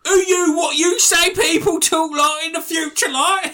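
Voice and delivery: high, loud mocking whine of his words; mocking parrot. Mimicking his friend's question in a high mocking whine. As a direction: throw his question back in a silly high voice.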